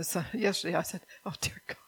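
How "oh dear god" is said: breathy, whispered plea; exasperated sigh. Real, not acted. Tired mother sighing to God over her son, whispered. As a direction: whisper it, worn out and half laughing. Exasperated aside after laughing.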